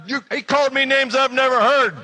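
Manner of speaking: loud, very high outburst; furious rant. Acted. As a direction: yell the final grievance. Furious rant at friend continues.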